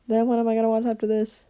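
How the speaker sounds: ordinary talk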